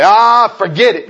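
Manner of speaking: loud, high dismissive sigh of giving up; mock giving up. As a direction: toss it off like a quitter.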